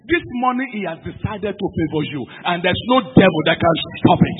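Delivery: very loud, rousing pulpit declaration; full-force preaching. Real, not acted. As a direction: thunder the promise out to the congregation.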